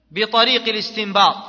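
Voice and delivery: ordinary talk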